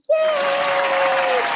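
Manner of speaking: high squealed yay; a delighted little cheer. Real, not acted. Host cheering her guest's introduction, high and excited.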